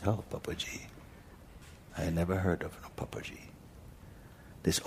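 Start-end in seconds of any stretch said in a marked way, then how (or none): 0.1-3.5 whispered aside. Retold or imitated. Polite puzzled reply.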